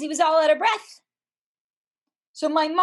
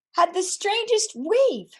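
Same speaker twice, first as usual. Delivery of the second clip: surprised; 8 semitones higher than usual. Real, not acted. Wondering at the strange wave.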